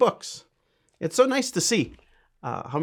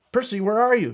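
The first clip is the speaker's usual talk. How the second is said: a higher, louder call into the cave; 9 semitones higher, 4 dB louder than usual. Acted. Little penguin calling for her missing friend, higher and louder. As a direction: call for your lost friend, a little worried. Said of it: "she cried".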